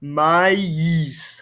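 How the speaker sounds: "Maïs" with the two vowels split, ma-ees. Shown as a demonstration. Teacher models maïs for the class, "Maïs" said as ma-ees. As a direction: separate a and i in "Maïs". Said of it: "difference between the A sound and the I sound".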